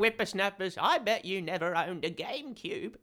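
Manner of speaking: lightly voiced grumpy old-timer; old prospector. Mock-elder scolding youngsters.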